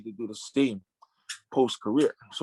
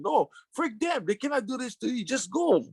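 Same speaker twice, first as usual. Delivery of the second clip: crying servants protesting; 10 semitones higher than usual. Retold or imitated. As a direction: imitate the upset boys insisting. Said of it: "they were, like, crying".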